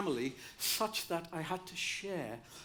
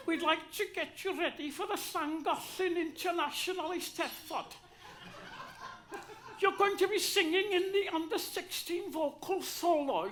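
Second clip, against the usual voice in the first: higher, lilting North Welsh teacher announcing; 10 semitones higher than usual. Retold or imitated. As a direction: proudly announce in a prim Welsh lilt. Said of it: "they all speak like this".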